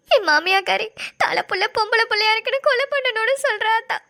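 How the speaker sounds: tearful, breaking voice, begging between sobs; crying plea. Acted. Young mother begging the elder woman to save her baby, crying. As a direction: plead through tears to save the child. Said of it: "அழுவாத".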